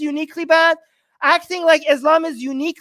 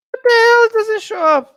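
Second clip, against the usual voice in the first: frustrated outburst; 7 semitones higher, 4 dB louder than usual. Real, not acted. Frustrated searching YouTube.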